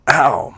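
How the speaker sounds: a loud, high yelp of pain; sharp yelp of pain. Acted. Struck by his hand, she yelps loudly in pain. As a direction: yelp as the hand lands hard.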